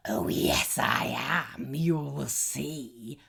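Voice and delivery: troll taunting the goat, gruff. A narrator's reading. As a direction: gloat like a troll.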